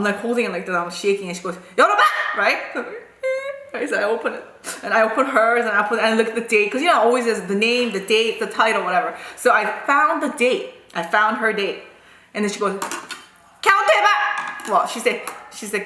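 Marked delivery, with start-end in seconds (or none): 1.8-2.1 high, loud mock bark; commanding teacher. Teacher ordering shaking pupil to open book, loud imitated yell. 13.6-14.7 high, sharp imitated order; commanding teacher. Teacher ordering pupil to count, high mock yell.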